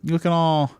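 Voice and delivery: normal talking voice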